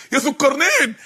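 ordinary talk, nothing marked

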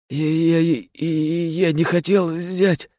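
put-on high, stuttering frightened boy; terrified stammer. A narrator's reading. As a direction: stammer it out in panic. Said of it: "просипел невнятно юноша".

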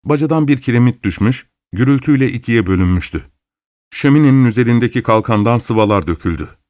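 ordinary delivery

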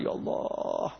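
a breathy private plea; whispered prayer. Retold or imitated. Private prayer during salat. (doa berbisik)

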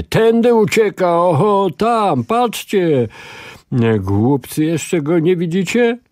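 man shouting directions to the bandits. A narrator's reading. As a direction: call out to the others to chase. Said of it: "słyszał ciągle krzyki mężczyzny".

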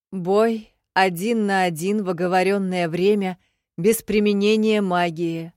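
formal proclamation. A narrator's reading. Secretary announces the duel rules to the hushed crowd, proclaiming loudly. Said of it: "возвестил секретарь в тишине".

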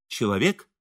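usual speaking voice

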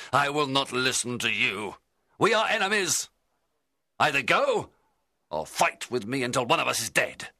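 furious defiance. Acted. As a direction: challenge the monster to a fight. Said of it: "I cried".

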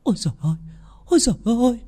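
high, frightened gasp of alarm; terrified stammer. A narrator's reading. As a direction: stammer it out in rising horror. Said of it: "Bà ấy lắp bắp".

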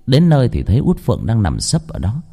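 ordinary delivery

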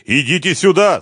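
high, loud summons; urgent yell; shout. A narrator's reading. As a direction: call someone over at full voice. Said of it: "закричал он Машкову".

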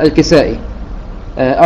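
usual speaking voice

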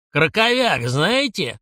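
a high, mildly barked demand. A narrator's reading. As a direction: bark the question at the band. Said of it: "рявкнул Сабуров". (лёгкий рявк на оркестр)

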